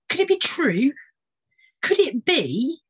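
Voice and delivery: high breathless hope; barely daring to believe; excited wonder. Acted. Ornithologist hurrying to the courtyard, eager.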